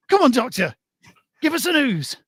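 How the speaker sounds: high, urgent appeal to the doctor; pleading joke patient. Acted. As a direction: press for the news in a high voice.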